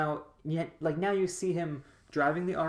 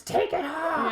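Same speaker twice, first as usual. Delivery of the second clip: high, loud heckler's yell; rowdy mock shriek from the crowd; imitated scream; 13 semitones higher, 5 dB louder, slower than usual. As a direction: mimic a woman screaming at a stripper. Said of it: "one woman literally screams".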